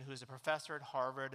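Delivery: ordinary delivery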